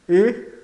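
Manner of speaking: E as a closed "ê". Shown as a demonstration. Teacher contrasting two vowels for learners, E said closed. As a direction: hold E closed, like Portuguese "ê". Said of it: "o E soa fechado".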